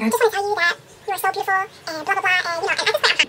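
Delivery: high, fast mock Southern guy; lightly voiced Southern admirer, rushed and high; Southern accent. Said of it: "He had a serious Southern accent".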